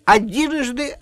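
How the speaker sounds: announcing showman. Acted. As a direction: call it out like a showman. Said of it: "сказал Ваня".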